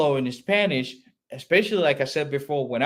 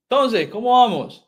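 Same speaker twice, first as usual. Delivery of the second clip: higher, louder acted hello; lively greeting; 8 semitones higher, 6 dB louder than usual. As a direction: play the other person greeting back brightly. Acts other person's informal greeting. (animated casual greeting)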